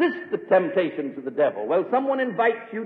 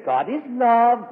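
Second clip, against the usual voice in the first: slow, drawn-out, raised mocking pitch; syrupy mock piety; 5 semitones higher, 3 dB louder, slower than usual. Preacher showing the devil's way of saying it, syrupy mock piety. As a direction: draw out LOVE in mock sweetness. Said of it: "Not in that tone of voice".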